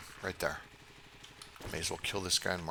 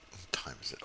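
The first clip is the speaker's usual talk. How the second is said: whispered, softer question to himself; 5 semitones lower, 5 dB quieter than usual. Real, not acted. Asking himself the time, whispered. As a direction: whisper it under your breath. Checks time in-game, frustrated.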